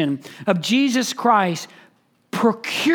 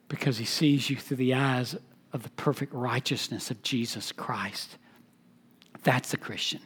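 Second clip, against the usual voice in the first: much lower and far softer, intimate; a pastor softly comforting his flock; tender hush; 9 semitones lower, 11 dB quieter than usual. Real, not acted. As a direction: drop to a hushed, tender reassurance. Tender reassurance to the congregation.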